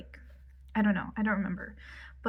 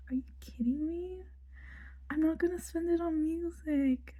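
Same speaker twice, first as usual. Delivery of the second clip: much higher, put-on voice; mock thrilled disbelief; 8 semitones higher than usual. Cellist to Anne about the grant, high mock disbelief. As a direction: squeal it lightly in retold delight.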